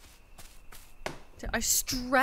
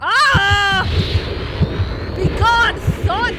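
loud scared shout; startled scream; 6 semitones higher, 11 dB louder, much slower than usual. Real, not acted. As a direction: yelp in real fright. Scared by ghost mid-rite.

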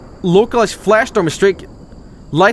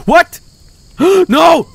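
alarmed cries; 10 semitones higher than usual. Real, not acted. Snake attacks his cat.

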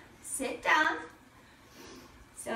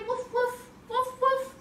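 high, light little woofs; playful small-dog yaps; dog bark; 7 semitones higher than usual. Pretend. Teacher playing downward dog with kids, high playful barks.

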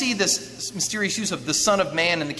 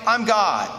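half-raised, louder calling out; mock street shout; 5 semitones higher, 4 dB louder than usual. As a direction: imitate someone hollering down the street. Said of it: "shouting in the streets".

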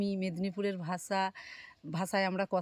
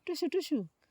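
coaxing the bird; 3 dB louder, slower than usual. Real, not acted. Coaxing the pet bird to talk.

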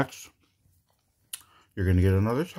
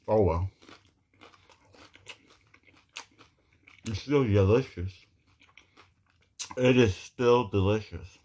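talking while chewing food; speaking between bites; mouth full, chewing. Real, not acted. Seen 3: feeds shredded filling into mouth, chews while speaking.

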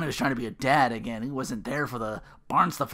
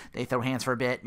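normal talking voice

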